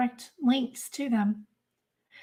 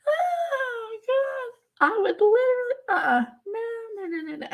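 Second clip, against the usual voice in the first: high, teary laughter through the words; laughing to tears; 11 semitones higher than usual. Real, not acted. Vlogger laughing to tears at the thought, high and teary. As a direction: wheeze out the words between laughs.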